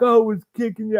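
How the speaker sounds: high mock voice of a cocky guy; mocking the boastful opponent. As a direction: imitate a bragging sparring partner.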